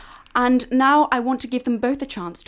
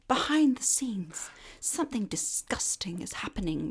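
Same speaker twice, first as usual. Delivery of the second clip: whispering; 2 semitones lower, 9 dB quieter than usual. Acted. Dramatic reveal to listeners.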